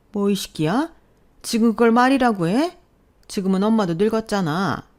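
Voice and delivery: indignant mother. A narrator's reading. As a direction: scold him with rising outrage. Mother scolding son in quarrel.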